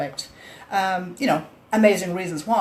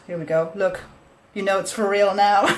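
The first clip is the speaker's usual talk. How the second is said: ordinary talk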